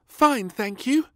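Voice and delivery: put-on high, slow little girl voice; a small girl answering politely; little princess voice. Princess answering her father, high little girl voice. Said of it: "she said".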